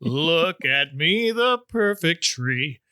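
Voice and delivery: put-on slow, singsong Swedish accent; mock Swedish accent. Mocking her Swedish sung line.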